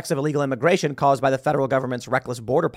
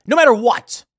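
emphatic rant; 7 semitones higher, 6 dB louder than usual. Real, not acted. Host ranting about urban liberal voters, loud and high. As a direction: punch it out higher and louder.

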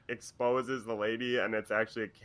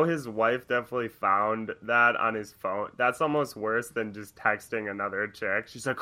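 ordinary talk, nothing marked

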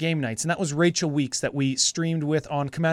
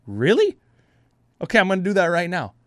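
excited; 3 semitones higher, 5 dB louder, faster than usual. Retold or imitated. Retold excited reply.

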